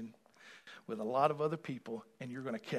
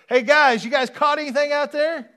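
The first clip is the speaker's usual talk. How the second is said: much higher, loud, breezy greeting; casual Jesus voice; 13 semitones higher, 17 dB louder than usual. Retold or imitated. Preacher voicing Jesus as a casual pal, high and loud. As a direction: call out like a buddy from the shore. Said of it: "he wouldn't have said it like that".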